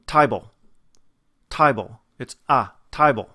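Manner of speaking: loud Australian "table", close to "tie-bull"; Australian accent. Shown as a demonstration. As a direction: punch out "table" with an Australian vowel. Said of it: "in an Australian dialect".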